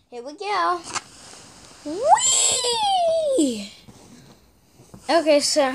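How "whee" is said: excited whee. Real, not acted. Riding down in the game.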